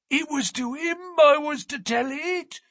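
put-on high, stubborn, sulky old voice; a cranky old man refusing to budge; petulant old man. A narrator's reading. Old man repeats himself to the narrator, petulant and obstinate. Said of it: "petulant obstinacy of a very old man".

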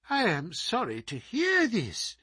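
feigned concern. Acted.

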